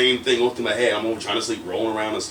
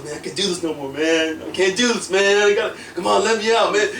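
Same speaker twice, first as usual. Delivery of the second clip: higher, whiny mock desperation; pleading movie kid; 6 semitones higher than usual. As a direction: beg like a kid who can't take it, building. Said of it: "you got the kid that goes".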